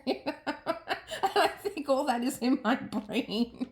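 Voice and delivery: talking through a laugh. Real, not acted. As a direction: laugh while admitting it. Laughing at memory.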